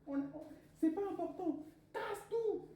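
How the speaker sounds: high, cheering parent voice; parents' voice. Retold or imitated. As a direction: egg her on, high and eager. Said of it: "Ils disaient".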